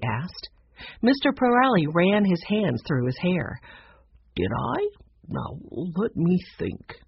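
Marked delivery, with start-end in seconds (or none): 4.4-7.0 slow, soft, puzzled musing; absent-minded conductor. A narrator's reading. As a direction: ponder it slowly, half to yourself. Absent-minded conductor tries remembering.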